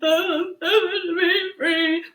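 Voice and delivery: loud, high imitated wailing; mock ugly cry. Co-host imitates woman's ugly cry on the show, loud mock bawling. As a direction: blubber wordlessly in exaggerated sobs. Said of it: "Stacy ugly cry".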